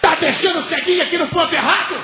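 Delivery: high-pitched scolding holler; crowd's indignant shout. Retold or imitated. Bus stop crowd scolds the driver, high indignant shout. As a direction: yell after the departing bus, outraged.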